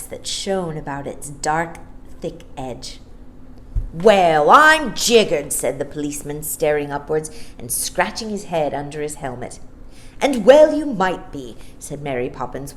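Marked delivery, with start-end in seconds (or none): 3.9-5.5 astonished policeman. Acted. 10.2-11.6 louder sharp snap at the policeman; Mary Poppins snapping at the policeman, louder; ferocious snap. A narrator's reading. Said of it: "with such a ferocious snap".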